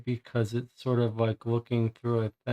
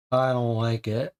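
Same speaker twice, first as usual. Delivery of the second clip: usual speaking voice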